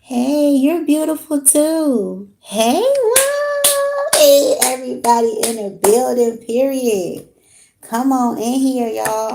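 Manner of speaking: high, loud, drawn-out hype welcome; loud excited greeting. Real, not acted. Streamer welcoming viewers into the live with a loud excited call. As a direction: call the whole room in like a party host.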